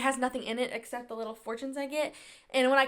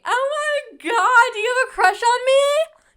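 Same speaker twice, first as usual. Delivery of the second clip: giddy squeal; 12 semitones higher, 13 dB louder than usual. Real, not acted. Teasing fortune cookie.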